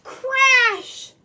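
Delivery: a big sudden crash; loud crash. A narrator's reading. Reindeer smack into tree, loud crash. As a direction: shout it high and loud.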